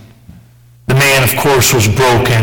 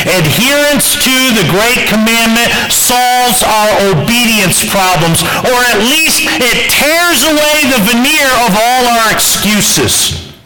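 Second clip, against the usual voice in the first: higher, intense pulpit voice; fervent preaching; 9 semitones higher, slower than usual. Acted. Preacher pressing the congregation, higher and fervent. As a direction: drive the point home to the congregation.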